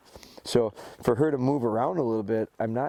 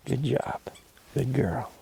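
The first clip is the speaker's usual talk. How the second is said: whispered praise; 2 semitones higher, slower than usual. Real, not acted. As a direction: whisper it gently to the dog. Soft praise after correction.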